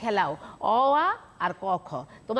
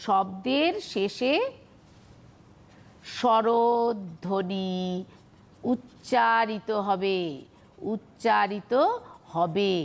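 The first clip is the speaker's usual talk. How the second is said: 1 slow dictation; much slower than usual. Shown as a demonstration. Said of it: "slow dictation of definition".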